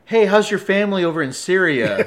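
mock-casual question called out loudly; prank announcement. Traveler loudly baiting his friend at security, conspicuous prank question. Prank remark meant to be overheard.

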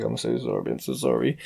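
low, quiet grovelling apology; mock begging. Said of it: "I've just had to beg it".

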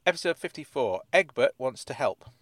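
ordinary talk, nothing marked

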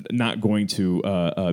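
ordinary talk, nothing marked

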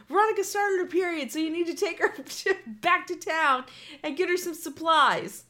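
fast, high, loud grandpa calling out; yelling grandpa. Retold or imitated. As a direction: rattle it off across the house. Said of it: "yelling to my grandmother".

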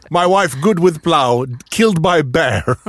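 put-on slow, broken-English Borat accent. Man quoting Borat lines to his co-host, slow Borat accent. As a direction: draw it out in a mock Borat accent. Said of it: "Borat-repliker".

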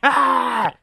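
short loud high yell of frustration; exasperated cry, loud and high; frustrated yell. Retold or imitated. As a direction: let out a fed-up shout. Venting frustration in story.

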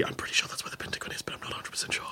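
fast whispered aside; whispering. Real, not acted. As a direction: hush it like a quick secret.

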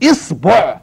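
"إصبع" with i then o, isboʕ; pronunciation variant. Shown as a demonstration. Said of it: "يا تفتحها يا تكسرها يا تضمها".

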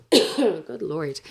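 normal talking voice

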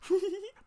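a high little giggle that gives the plot away; can't keep a straight face, giggle; guilty giggle. Retold or imitated. Otto caught scheming, high guilty giggle. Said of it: "incapable of keeping a straight face".

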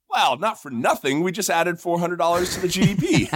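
punchline breaking into laughter; can't hold back a laugh at the end; delivering the joke's last line while laughing; laughing through the punchline. Real, not acted.

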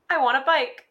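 put-on high, demanding little girl; bratty child voice. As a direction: whine for a bike like a spoiled kid. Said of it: "Miss Ashley says".

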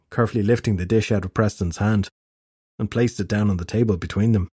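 usual speaking voice

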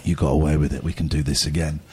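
the disease speaking in his head, low and tempting. Retold or imitated. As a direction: voice the addiction talking low and sly. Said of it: "the voice in my head said".

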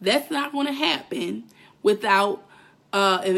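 ordinary delivery

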